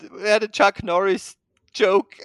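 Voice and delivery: real laughter still in the voice; laughing while talking. Real, not acted. Host talking through laughter.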